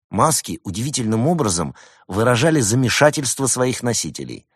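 ordinary delivery, nothing marked

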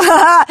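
loud, high jeering laugh; mocking laugh. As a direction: laugh at him.

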